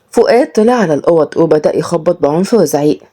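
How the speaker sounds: ordinary delivery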